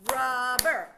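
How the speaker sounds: two strong punched beats on Rubber; clapped syllables. Shown as a demonstration. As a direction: clap out each part of Rubber. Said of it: "Let's clap it".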